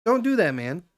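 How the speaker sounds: high, loud call to a friend. Retold or imitated.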